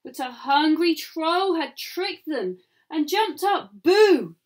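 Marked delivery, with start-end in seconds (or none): 3.8-4.3 loud boo. A narrator's reading. Troll jumps out.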